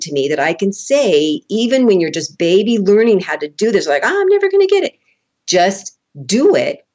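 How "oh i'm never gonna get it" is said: high-pitched whiny beginner; exaggerated high moan of giving up; mock despair. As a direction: whine it as a discouraged learner. Voicing a discouraged beginner.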